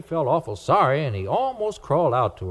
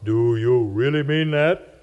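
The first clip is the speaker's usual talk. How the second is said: a slow, mysterious voice; slow and eerie, like a spirit; strange voice; slower than usual. A narrator's reading. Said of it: "says a strange voice".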